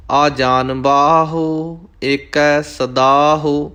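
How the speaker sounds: slow, sustained devotional chant; scripture recitation. Acted. Teacher reciting scripture for learners, slow chanting. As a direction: recite measuredly, drawing out each line's last syllables.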